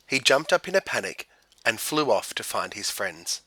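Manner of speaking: ordinary delivery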